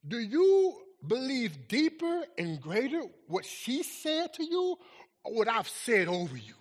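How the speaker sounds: normal talking voice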